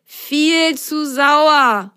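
high drawn-out yuck; disgusted. Acted. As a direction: draw it out with a sour face. Refuses sour juice.

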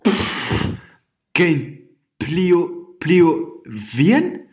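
high, slow stumbling confusion; baffled. Acted. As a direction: trip over the word. Rehm stumbles on the word.

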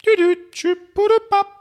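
very high squeaky beeps and blips; beeping noises. Pretend. Host mimics a breakup text's beeps, squeaky high. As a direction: squeak out little phone beeps. Imitating a message beep sound.

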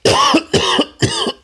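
hard real coughing fit. Real, not acted. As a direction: cough through it. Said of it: "ich hab jetzt einen Hustenanfall".

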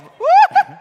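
loud, high whoop with a laugh; excited cheer. Real, not acted. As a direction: whoop for joy. Cheering at bingo numbers.

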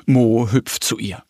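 ordinary delivery, nothing marked

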